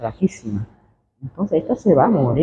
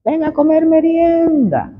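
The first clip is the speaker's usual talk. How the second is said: long, high call that drops at the end; 13 semitones higher than usual. Retold or imitated. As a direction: imitate a mother calling her child in to eat. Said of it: "En este momento me llama".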